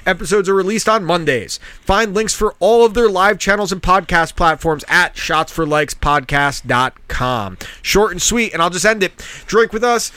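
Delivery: upbeat podcast ad read. Acted. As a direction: sell it like a radio spot. Reads an ad.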